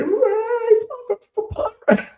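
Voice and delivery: very high squealed name; mocking the kid fan, a high squeal of his name.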